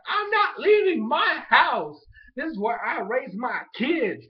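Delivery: put-on high, loud, slow old-lady refusal; playing grandma who refuses to leave her burning house, loud and high; stubborn grandma.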